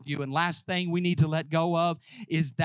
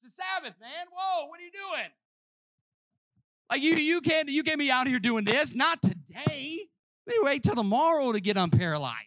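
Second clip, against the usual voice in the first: scandalised officials; 11 semitones higher than usual. Retold or imitated. Preacher voicing officials scolding the healed man, high alarm. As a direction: play the shocked rule-keepers.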